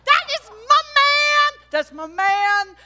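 triumphant shout. Retold or imitated. Wife cheering her husband's win, shouting. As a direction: scream with joy for your husband. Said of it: "I'm like".

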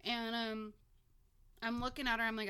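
normal talking voice

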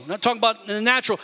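ordinary delivery

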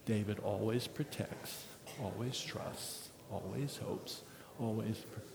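soft, low scripture recitation with own name; quiet responsive reading. Acted. Pastor leads the church reading the verses with his own name, quietly. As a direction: read it hushed, as a confession.

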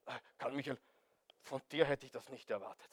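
low, soft, understated mock dismay; mock surprise. Preacher voicing a supposedly surprised God to Karl Michael, low mock surprise. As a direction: quietly act taken aback. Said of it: "er ist überrascht".

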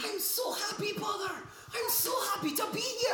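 high excited Irish brogue; giddy Irish lad; Irish accent. Acted. Irish son arriving.

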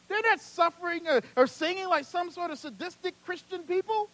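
high, loud, driving sermon delivery; impassioned preaching. Real, not acted. Preacher pleading for suffering believers to the congregation, impassioned.

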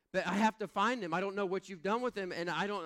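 mock frantic wife voice; imitating frantic wife. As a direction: rush it like a panicked wife. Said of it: "She responded and said".

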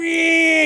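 loud, high held engine whine; pretend car noise. Preacher mimicking a kid in toy car, engine noise. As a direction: rev like a child playing cars. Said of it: "little pretend car and you're like".

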